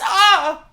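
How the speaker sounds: sharp high yelp of shock; startled yelp. Real, not acted. Streamer yelps at the clip, startled. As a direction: yelp at the video. Reacting to video, shouts.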